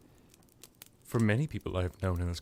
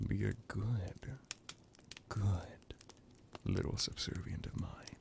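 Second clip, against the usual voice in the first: soft, slow, near-whispered purr; seductive coaxing; 8 dB quieter than usual. Acted. Vampire telling a captive to be a good servant, softly coaxing. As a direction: let the praise sink into a slow whisper.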